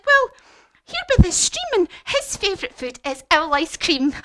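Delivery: high, loud mouse voice; animated storybook mouse; mouse giving a warning. Acted. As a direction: build to the scary punchline. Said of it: "And he said".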